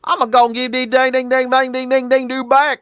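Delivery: high-pitched hillbilly twang impression; mock country old-timer accent. Host mocking the man in the clip, exaggerated country accent. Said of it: "guys that talk like this".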